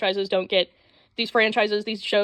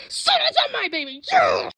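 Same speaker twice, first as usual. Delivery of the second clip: hype announcer; 11 semitones higher than usual. Real, not acted. Hyping favorite anime.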